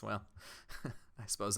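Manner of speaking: soft, low chuckle into the words; understated, wry little laugh in character; slight laugh. Acted. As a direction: laugh a little, then speak quietly. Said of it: "She sort of laughs and says".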